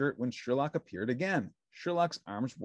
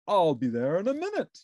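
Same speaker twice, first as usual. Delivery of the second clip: pompous detective voice, a touch louder; grand, self-important sleuth; Sherlock Hemlock voice; 6 semitones higher, 6 dB louder than usual. A narrator's reading. Detective answering Ernie's call, pompous and grand.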